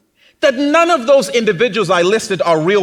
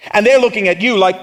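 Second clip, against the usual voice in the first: normal talking voice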